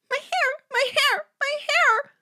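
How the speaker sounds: high, loud repeated wail; hysterical, piercing cries; screaming. A narrator's reading. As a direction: shriek it over and over. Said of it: "Hannah screamed".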